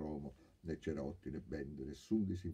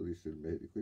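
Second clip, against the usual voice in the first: ordinary delivery, nothing marked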